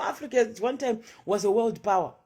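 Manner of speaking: usual speaking voice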